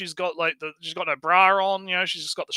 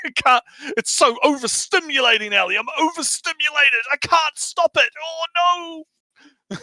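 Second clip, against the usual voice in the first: higher, hammed-up helpless whimper; mock overstimulated fan; 8 semitones higher than usual. Teasing co-host Ellie, higher hammed-up overstimulated whining. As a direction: play a fan losing control, over the top.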